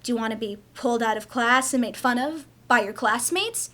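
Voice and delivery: ordinary talk, nothing marked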